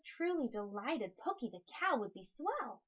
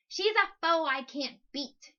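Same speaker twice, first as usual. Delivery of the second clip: crying out in defeat; 4 semitones higher, 7 dB louder than usual. Acted. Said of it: "he cried in defeat".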